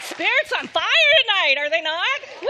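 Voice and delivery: rally the room with a shout; excited whoop. Real, not acted. Medium whooping to the audience after a reading.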